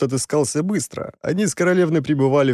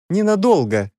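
anxious call after them, higher; 8 semitones higher, 3 dB louder than usual. Acted. Lorena calls after them anxiously, calling out. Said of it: "крикнула нам вслед Лорена".

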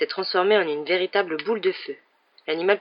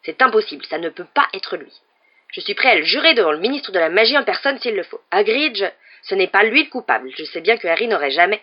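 loud, blustering rant; storming; 3 semitones higher, 7 dB louder than usual. A narrator's reading. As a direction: rage on, swearing to his innocence. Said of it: "Hagrid continua de tempêter".

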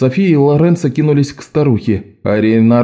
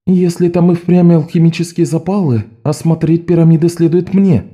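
resolute; 7 semitones higher than usual. A narrator's reading. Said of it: "решительно промолвила София".

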